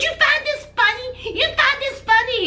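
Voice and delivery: high-pitched mock indignation; indignant challenge. Girlfriend confronting laughing boyfriend, indignant and high.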